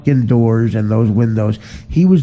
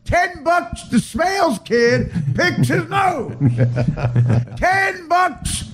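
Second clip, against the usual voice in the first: much higher shouted bets, laughing through; mock caddie shouting bets. Comic mocking celebrities with caddie betting shouts. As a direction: holler the wagers like a rowdy caddie. Caddyshack bet parody.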